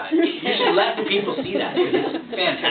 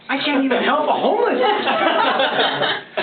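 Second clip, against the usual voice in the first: laughing slowly; 6 semitones higher, slower than usual. Real, not acted. Coach laughing at himself.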